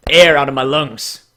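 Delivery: normal talking voice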